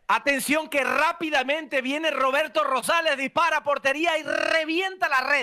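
high, drawn-out football commentary; goal call. Shown as a demonstration. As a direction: sing the goal out like a radio commentator. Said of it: "dime esa frase cantando un gol".

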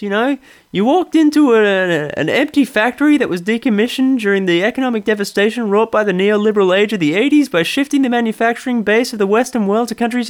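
mock Seinfeld's cadence; hammed-up Seinfeld parody; Jerry Seinfeld impression. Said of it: "my pseudo Jerry".

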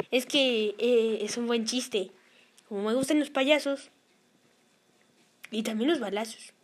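deep goofy puppet voice; low put-on voice. Puppet explaining the bird cakes in a deep voice. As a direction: drop way down like a dopey character.

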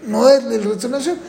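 usual speaking voice

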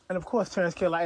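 normal talking voice

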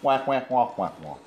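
wordless wah-wah, falling away at the end; Charlie Brown's teacher. Acted. Mocking an unintelligible accent to the co-host, cartoon wah-wah voice. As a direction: turn speech into honking wahs. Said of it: "If he was a Charlie Brown's teacher".

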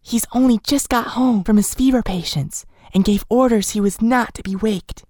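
a high, hushed servant voice, lightly done; lightly voiced cook. A narrator's reading. The cook telling Nellie her master sleeps, high and hushed. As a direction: keep it hushed, as if not to wake him.

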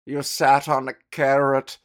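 loud, slow mock psychoanalyst; mock Freud. Podcaster mocking Freud's diagnosis, loud and slow. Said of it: "Freud would be like".

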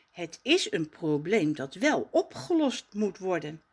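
usual speaking voice

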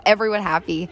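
ordinary talk, nothing marked

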